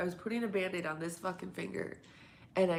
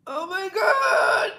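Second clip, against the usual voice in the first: much higher, loud, slow shout; 14 dB louder, much slower than usual. Real, not acted. Shrieking at something on stream. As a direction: yell it out in alarm.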